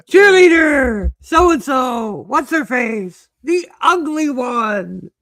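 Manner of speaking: high, drawn-out cartoon shout; Teen Girl Squad. Quoting the cartoon's intro to co-hosts, high mock announcer. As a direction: squeal the roll call in stretched, high tones. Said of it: "on the Teen Girl Squad mention".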